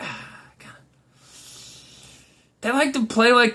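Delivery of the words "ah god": breathy whispered groan of disgust; very soft, voiceless eye-roll; exasperated whisper. Retold or imitated. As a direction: sigh it out, fed up. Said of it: "they'll be like".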